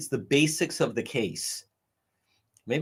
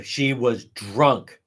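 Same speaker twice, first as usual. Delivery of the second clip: ordinary talk, nothing marked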